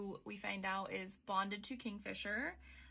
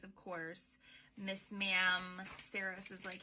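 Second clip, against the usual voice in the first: ordinary delivery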